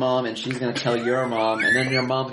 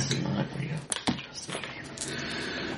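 much softer, slow breathy whisper; whispered to himself; 10 dB quieter, much slower than usual. Acted. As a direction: whisper it to block out the dream. Player repeats it to himself.

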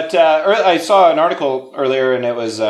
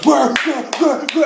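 high imitated machine buzz; mechanical buzz; 13 semitones higher, slower than usual. Storyteller imitating the electric bed closing, high buzzing. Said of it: "and it goes".

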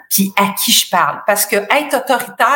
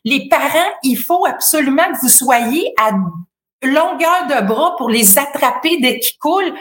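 higher, pressing lifeguard announcement; urgent warning; 5 semitones higher than usual. Shown as a demonstration. Lifeguard warns parents about drowning children, urgently. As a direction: alert the parents firmly. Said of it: "ma voix était un peu plus aiguë, j'ai parlé plus vite".

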